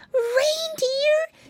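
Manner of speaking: very high, astonished squeak; exclaiming piggy boy. A narrator's reading. Piglet overhearing Santa's call, astonished exclamation. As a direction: squeal the word in amazement. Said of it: "exclaimed Flop Ear".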